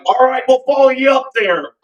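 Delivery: put-on high, strong Philly accent; JoJo Philly accent. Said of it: "I do his perfect Philly accent too".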